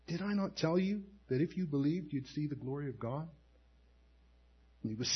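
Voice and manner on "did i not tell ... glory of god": soft, hushed gentle question; Jesus gently reminding Martha, softer. Acted. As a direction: ask it tenderly, almost under the breath. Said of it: "it's very, very gentle".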